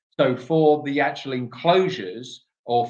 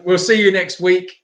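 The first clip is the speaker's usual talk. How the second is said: ordinary delivery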